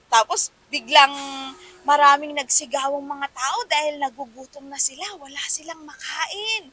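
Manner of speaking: a touch of dramatic story voice; storytelling voice. Shown as a demonstration. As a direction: tell it like a lively children's story. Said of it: "medyo iniiba-iba mo din".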